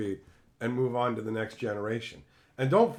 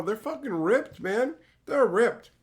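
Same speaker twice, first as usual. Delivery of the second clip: higher, amazed exclaim; excited; 9 semitones higher, 3 dB louder, slower than usual. Real, not acted. Streamer marveling at kangaroos to chat, excited higher pitch. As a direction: pitch up with awe at the muscles.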